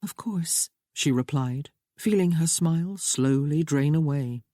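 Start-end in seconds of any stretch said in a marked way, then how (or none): 0.0-0.7 a higher, lightly voiced young woman, earnest and pleading; young bride Sophia. A narrator's reading. Bride reassuring her new husband, raised lighter voice. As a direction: play the bride gently begging James to understand.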